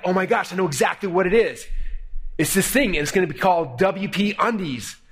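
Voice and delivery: high-pitched eager rush of discovery; excited. Retold or imitated. Partners hit on their big idea, excited. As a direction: retell the big discovery, thrilled. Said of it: "we're freaking out excited".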